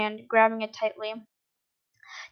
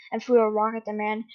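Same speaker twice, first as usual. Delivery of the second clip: ordinary delivery, nothing marked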